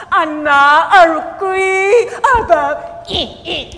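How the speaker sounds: put-on slow, loud wailing fuss over the child; mock Filipino parent fussing. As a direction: overdo the pity. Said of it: "How do you Filipino teach".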